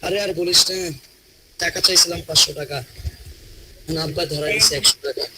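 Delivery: mildly complaining, rising and falling tune; up-down Bangla tone. Acted. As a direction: let the tune swing up and down as you moan to a friend. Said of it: "আপনার টোনটা দেখেন একটু up down করছে".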